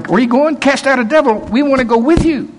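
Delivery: high, pleading, eager voice; eager begging. Retold or imitated. Young men begging the preacher to take them along, high and eager. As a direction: beg to tag along, high and keen.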